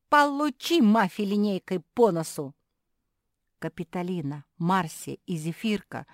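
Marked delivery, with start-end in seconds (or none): 0.1-2.5 loud, high gleeful attack cry; battle shout. A narrator's reading. Sister striking with a ruler, shouting. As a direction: shout it as you land a blow.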